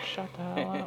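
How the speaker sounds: high squeaky voice for the dogs; voicing the barking dogs, high; annoyed dog voice. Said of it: "They're like".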